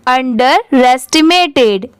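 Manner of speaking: अंडरएस्टिमेटेड fairly slowly, अंडर then रेस्टिमेटेड; measured अंडरएस्टिमेटेड with a slight break after अंडर; parts partly joined. Shown as a demonstration. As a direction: join the syllables of अंडरएस्टिमेटेड carefully. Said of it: "और भी part में divide कर दिया".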